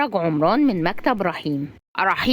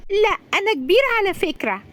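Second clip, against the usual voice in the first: put-on very high little-kid voice; high, childish objection; small child protesting; 13 semitones higher than usual. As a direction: insist like a proud four-year-old. Four-year-old protests to an adult.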